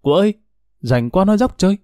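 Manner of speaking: calling out. Retold or imitated. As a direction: call across the fence, light and high. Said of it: "con nghe bà ngoại hay kêu bà cụ".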